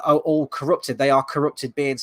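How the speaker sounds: ordinary talk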